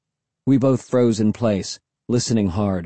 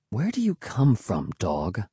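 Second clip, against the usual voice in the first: soft musing question, falling low; wondering; 3 semitones lower, 6 dB quieter than usual. A narrator's reading. Narrator puzzling over the dog, soft wondering. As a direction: wonder quietly, half to yourself. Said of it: "I wondered".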